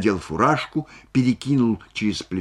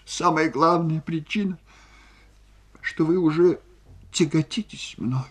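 high-pitched, halting sobbing voice; woman choking back sobs; tearful reproach; 9 semitones higher than usual. A narrator's reading. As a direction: accuse through tears, breaking between phrases. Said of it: "всхлипнула Ольга Ивановна".

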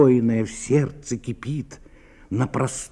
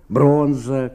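normal talking voice

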